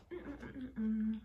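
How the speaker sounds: savoring hum; pleased humming over food; mmm of delight. Real, not acted. Tasting the sushi.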